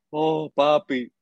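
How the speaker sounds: slow, drawn-out fond "oh, papi"; lightly voiced drill sergeant, affectionate; sergeant's pet name, drawled slowly; imitating the sergeant. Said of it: "él siempre decía".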